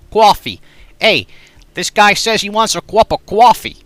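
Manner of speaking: loud Boston voice, coffee as kwaffee; Boston guy ordering loud; Boston accent. Shown as a demonstration. Chat asks streamer for a Boston accent.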